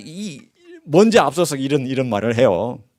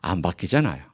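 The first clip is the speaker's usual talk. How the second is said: "안 바뀌잖아요" dropped low and much softer; quiet knowing answer; 6 semitones lower, 8 dB quieter than usual. Real, not acted. Preacher answering his own question to the congregation, softly. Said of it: "여러분의 기질이 바뀌든가요".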